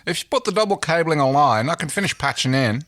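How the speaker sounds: put-on impatient Australian accent; a hurried, impatient Australian tech. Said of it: "She sounds impatient".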